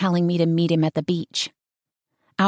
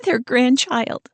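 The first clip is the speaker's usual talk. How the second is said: higher and slow through tears; crying; 6 semitones higher, slower than usual. Acted. Sister on the phone, crying over her grandchild. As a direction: squeeze the words out while crying. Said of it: "she begins to cry again".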